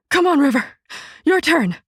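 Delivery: loud, high breathless call; puffing. A narrator's reading. Mother out of breath calling her son aboard, puffing. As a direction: call him in between puffs. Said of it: "she puffed".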